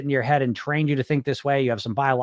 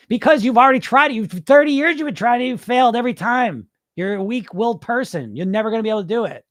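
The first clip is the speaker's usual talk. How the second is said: higher, louder nagging voice in the head; acted scolding, pitched up and insistent; berating inner critic; 7 semitones higher, 4 dB louder than usual. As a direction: lay into the listener as their harsh inner critic.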